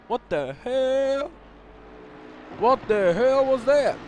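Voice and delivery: can't believe what he just saw; stunned disbelief. Real, not acted. Commentator asking viewers about the Porsche, slow incredulous outburst.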